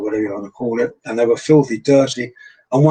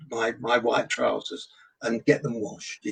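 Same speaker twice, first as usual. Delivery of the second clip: usual speaking voice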